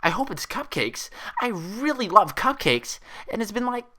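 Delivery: high, gushing, bouncy voice; excited. Acted. Pinkie gushing about cupcakes, high and excited. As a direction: squeal over cupcakes. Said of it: "bounce excitedly".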